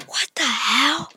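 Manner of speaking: breathy unvoiced whisper of a swear; mock whisper. Shown as a demonstration. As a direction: whisper it like a forbidden word. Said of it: "If you're very, very ... very mean".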